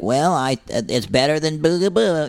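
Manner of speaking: high, put-on cartoon critter voice; cartoon animal voice. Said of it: "I would say, like".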